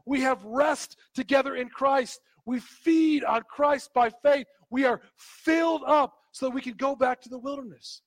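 forceful, emphatic pulpit delivery; insistent exhortation to a congregation; impassioned preaching. Real, not acted. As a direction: preach it with conviction, punching the key words.

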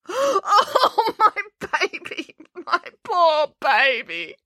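very high, slow sobbing wail; cling to the dog and wail. Acted. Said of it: "wailing tears of devastation".

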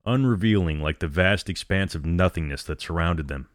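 plain reading voice

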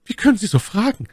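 high-pitched voice for the lady; Milady's voice. A narrator's reading. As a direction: play the lady far up in pitch. Narrator voices Milady in dialogue. (hohe Stimme der Mylady)

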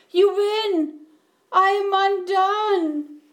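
loud, high-pitched acted wailing; blubbering. A narrator's reading. Bear falls weeping before the mouse, blubbering. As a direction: wail in utter, overplayed defeat. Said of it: "he blubbered".

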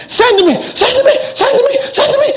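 very high, excited pleading; like a kid begging to be picked; eager volunteer. Retold or imitated. Isaiah volunteering again.

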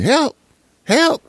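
very high little yelps for help; little dog yelping. Retold or imitated. Owner translating his barking dogs, very high yelps. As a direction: squeak like a tiny scared dog. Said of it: "They go barking".

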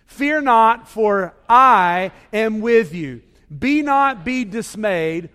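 slow recitation with a long, loud I; stress on I. Shown as a demonstration. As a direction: lean hard on the word I, stretching it out. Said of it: "emphasize the word "I".

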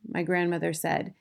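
ordinary delivery, nothing marked

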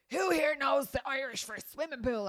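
loud, high, slow teacher bark; impatient teacher shouting a question; angry teacher. Retold or imitated. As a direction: scold the class like the old teacher. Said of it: "And he was like".

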